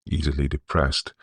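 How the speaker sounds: usual speaking voice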